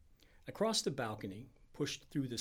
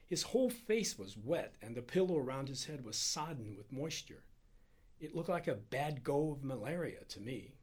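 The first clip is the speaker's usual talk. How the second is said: ordinary delivery, nothing marked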